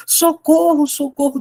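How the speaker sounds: high panicked cry for help; desperate cry. Acted. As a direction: shout for help like a scared boy. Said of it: "desesperado, gente". (grito desesperado de socorro, agudo)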